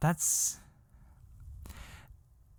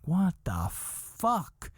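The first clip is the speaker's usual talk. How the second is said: slow, higher-pitched incredulous outburst; disbelief; 6 semitones higher, much slower than usual. Retold or imitated. Comic retelling his shock at a friend's story, disbelieving. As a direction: stretch it out, aghast.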